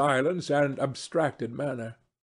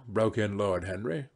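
plain reading voice

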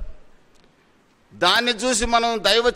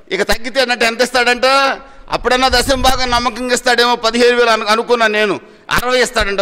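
louder, rising pulpit outrage; indignant preaching; 5 dB louder than usual. Acted. Pastor scolding a stingy believer's tithe, loud and indignant. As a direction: press the congregation with mock disbelief.

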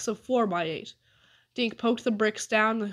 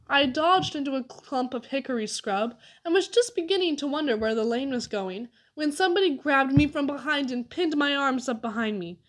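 plain reading voice

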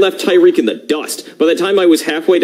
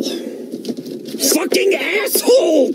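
high breathless scream; strained whispered scream; 3 dB quieter, much slower than usual. Real, not acted. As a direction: blow it out as a strangled scream.

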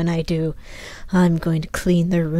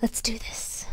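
soft whisper; 12 dB quieter, slower than usual. Acted. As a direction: whisper it, psyching yourself up. Bracing herself to touch it.

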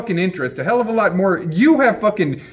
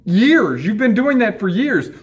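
normal talking voice

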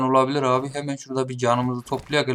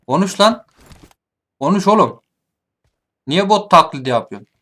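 raised, louder challenging demand; 4 semitones higher, 5 dB louder than usual. Real, not acted. Player confronts enemy who faked being a bot, loud demand. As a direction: demand an answer, voice pushed up.

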